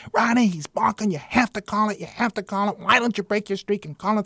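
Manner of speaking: loud, high-pitched hectoring manager; retold screaming. Manager screaming at the umpire over balks, high retold yell. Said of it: "he's screaming at me one day".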